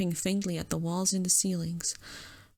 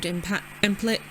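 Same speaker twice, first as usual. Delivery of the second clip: usual speaking voice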